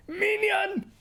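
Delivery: very high, squeaky cartoon minion cry. Greeting chat with a high minion squeak. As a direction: shriek it like a tiny minion. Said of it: "Φωνάζω, θα πνιγώ".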